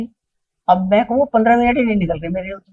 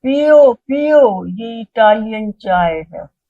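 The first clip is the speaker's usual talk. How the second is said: Baba Ji; 3 semitones higher, 7 dB louder, much slower than usual. Retold or imitated. Guru offering Italian tea, urging in long calls. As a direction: imitate a holy man pressing guests to drink. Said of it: "तो बाबा जी".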